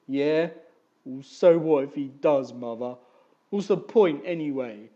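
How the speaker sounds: loud, despairing wail. Acted. Eric wailing at his mother, loud and despairing. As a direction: whine it out in defeat. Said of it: "wailed Eric".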